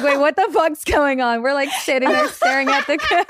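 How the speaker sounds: laughing retelling. Retold or imitated. Retelling the call drop to her friend, laughing high. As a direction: giggle it out as the shock wears off.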